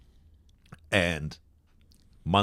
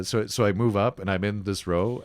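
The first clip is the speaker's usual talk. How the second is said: normal talking voice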